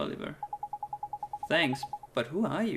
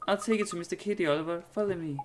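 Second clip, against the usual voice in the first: higher, quick and eager, leading the way; eager little girl voice; 7 semitones higher, faster than usual. Acted. Little girl P offering to lead Oliver, higher and quick. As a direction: chirp it like a small girl guide.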